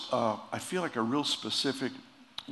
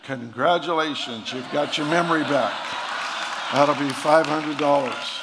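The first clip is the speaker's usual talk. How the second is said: louder, higher, slowing on the price; gleeful punchline; 4 semitones higher, 9 dB louder than usual. Acted. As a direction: crow the bill out, slowing on it. Doctor Geezer's punchline, building.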